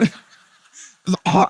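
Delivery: usual speaking voice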